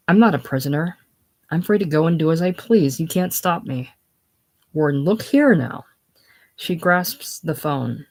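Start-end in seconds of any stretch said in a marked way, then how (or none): none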